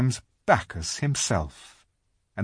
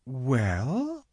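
hesitant, drawn-out word; rather slowly; 3 semitones higher, 4 dB quieter than usual. A narrator's reading. Lucy trying not to be too hard on him, hesitant. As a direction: stretch it out doubtfully. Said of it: "said Lucy rather slowly".

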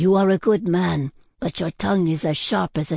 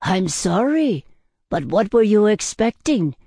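raised, higher-pitched reproach; scolding; 5 semitones higher, 5 dB louder than usual. A narrator's reading. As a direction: tell the drunk off, building to a loud rebuke. Tavern owner scolds the drunk.

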